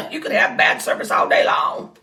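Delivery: loud, high laughing put-down; laughing retort. Retold or imitated. Laughing retort to racist customer.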